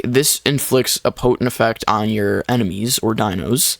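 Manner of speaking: normal talking voice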